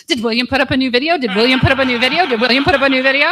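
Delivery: eager nephew. Retold or imitated. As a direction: ask it over and over like an impatient kid. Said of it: "my nephew's going".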